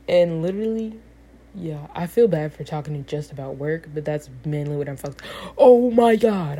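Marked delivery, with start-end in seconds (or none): 5.6-6.6 loud, high, drawn-out outburst; sudden realization. Real, not acted. Speaker suddenly remembers next week's test, loud startled outburst. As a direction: cut yourself off with a real, startled exclamation.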